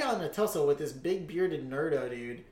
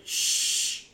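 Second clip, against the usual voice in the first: imitated shushing; 3 dB louder than usual. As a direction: shush like the annoyed moviegoer. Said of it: "he's like".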